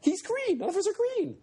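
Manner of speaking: very high-pitched mock shriek; mock screaming. Freaked-out kid arguing with classmates, mock screaming. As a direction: imitate a kid frantically protesting. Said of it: "And he starts screaming".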